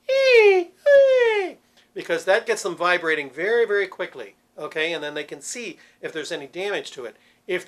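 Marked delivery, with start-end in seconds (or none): 0.1-1.6 a very high, loud falsetto "ee"; high-pitched ee. Shown as a demonstration. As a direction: shoot up to a thin falsetto "ee". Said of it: "a high-pitched sound like that".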